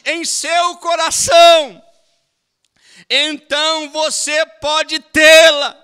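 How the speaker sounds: fervent preaching, high and loud; preaching at full force. Acted. Preacher urging the church to want the whole Bible, fervent preaching. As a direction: preach it to the congregation with fire.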